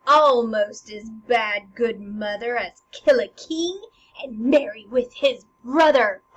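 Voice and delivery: loud, slow accusing stage voice; Hamlet. Acted. Hamlet accusing good mother, loud drawn-out declamation. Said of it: "And Hamlet goes".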